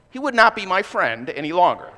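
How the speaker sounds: ordinary talk, nothing marked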